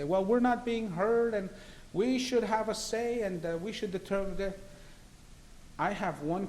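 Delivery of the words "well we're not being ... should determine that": mocking the advocates. Mimicking victims' rights advocates, mocking and higher-pitched. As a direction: parody their grievance, voice climbing up. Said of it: "they say".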